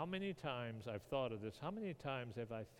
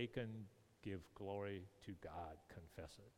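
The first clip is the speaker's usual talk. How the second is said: softer, hushed command to confess; quiet solemn plea; 4 semitones lower, 9 dB quieter than usual. Retold or imitated. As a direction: Joshua quietly urging Achan. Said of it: "and he say".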